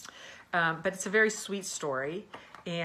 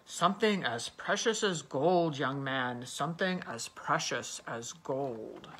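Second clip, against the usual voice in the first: put-on low, slow, booming old man; slow rumbling giant voice, dropping low; giant's voice; 3 semitones lower, slower than usual. A narrator's reading. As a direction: make it deep and grand like a giant.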